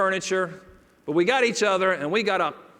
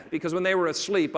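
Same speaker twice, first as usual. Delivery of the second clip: ordinary talk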